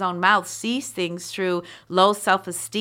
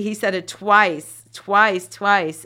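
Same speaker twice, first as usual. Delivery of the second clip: each twice louder, punched down; emphatic repetition; 6 dB louder, slower than usual. Real, not acted. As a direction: hammer the word twice home, slower. Preacher hammering the word twice.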